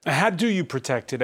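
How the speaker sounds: ordinary talk, nothing marked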